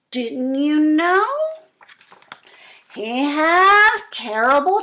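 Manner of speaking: scare the fox with every terrible detail; loud slow storytelling mouse making the monster sound huge; dramatic mouse. Acted.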